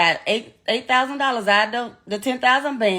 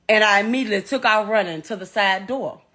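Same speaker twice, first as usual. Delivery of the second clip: ordinary talk, nothing marked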